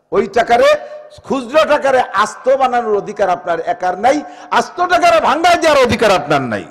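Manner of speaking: ringing preacher's chant; full-force preaching. Acted. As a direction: call it out to the whole mosque.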